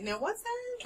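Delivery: high mock-puzzled voice; confused person. Friend imitates people puzzled by podcasts in a high mocking voice. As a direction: play a baffled person. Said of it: "Some people look at me and go like".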